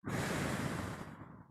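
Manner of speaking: long breathy blow; mouth blow out. Shown as a demonstration. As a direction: blow the air out slowly. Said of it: "this time we'll blow out of the mouth".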